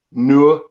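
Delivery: "no" with a long centring vowel, like noo-uh; Geordie "no". Shown as a demonstration. Showing the word that gives exiles away, broad Geordie "no". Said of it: "instead of "no".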